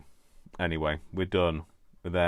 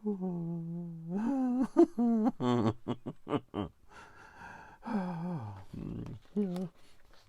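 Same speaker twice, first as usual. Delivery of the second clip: long high giggle winding down; drawn-out real laughing fit; helpless laugh; 12 semitones higher than usual. Real, not acted. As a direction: try to stop laughing and fail.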